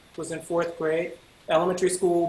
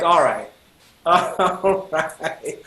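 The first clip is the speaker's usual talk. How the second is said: grudging challenge; much slower than usual. Retold or imitated. Retells grudgingly taking up challenge.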